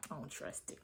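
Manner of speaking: unvoiced whisper; whispered aside. Real, not acted. Vlogger whispering her distrust to viewers. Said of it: "aside about the reunion".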